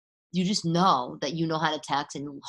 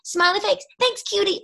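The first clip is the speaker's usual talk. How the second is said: high, bubbly mock teen voice; squealing teen texter, pitched way up; giddy teen texter; 5 dB louder than usual. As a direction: read the texts like a giggly teenager. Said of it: "every text is".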